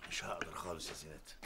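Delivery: fast hushed whisper. Real, not acted. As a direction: whisper so the meeting can't hear. Father whispers in meeting.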